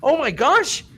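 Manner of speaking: high-pitched giddy disbelief, imitated. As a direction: squeal it as if amazed at a second chance. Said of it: "They are giddy. They're like".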